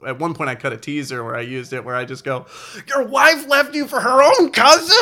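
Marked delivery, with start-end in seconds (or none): 2.9-5.0 high, loud, slow, laughing question; gleeful disbelief. Retold or imitated. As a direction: savor the juicy reveal with laughter. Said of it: "I couldn't be more happy about it".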